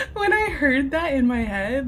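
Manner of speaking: high laughter through words; laughing. Real, not acted. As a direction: laugh while telling it. Laughing at her reaction.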